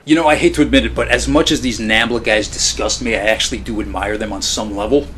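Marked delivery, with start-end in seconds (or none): none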